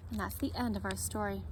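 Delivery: usual speaking voice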